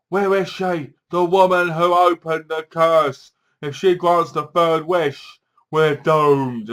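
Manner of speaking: loud, slow dramatic pronouncements; hammed-up ominous warrior demand; doom-laden hunter. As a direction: declaim it like a mock prophecy. Voices sword-wielding Hunter interrogating woman.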